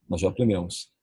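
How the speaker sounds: ordinary talk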